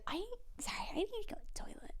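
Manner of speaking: high, tiny hushed squeak; embarrassed whisper. Retold or imitated. Kid to surf teacher needing toilet, sheepish whisper. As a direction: confess it quickly under your breath.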